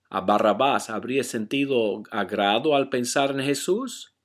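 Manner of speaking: normal talking voice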